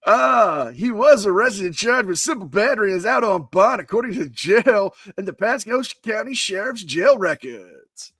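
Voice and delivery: overhyped club DJ patter. Acted. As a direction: swoop up and down like a hyped-up DJ. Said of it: "as I slip into my D J voice".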